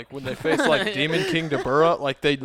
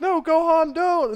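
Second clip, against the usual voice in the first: high-pitched mock plea; pleading warning; 11 semitones higher, slower than usual. Everyone begging Gohan to stop, pleading. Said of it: "everyone's like".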